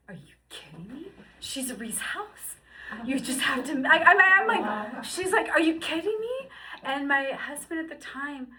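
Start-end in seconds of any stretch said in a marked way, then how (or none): 0.1-6.5 rising high, loud disbelief; indignant high protest; incredulous outrage. Retold or imitated.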